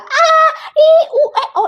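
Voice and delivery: big open-mouthed vocal warm-up; shouted vowel warm-up. Shown as a demonstration. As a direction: belt out each vowel like a voice exercise. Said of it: "nggak latihan dulu, nggak teriak-teriak dulu".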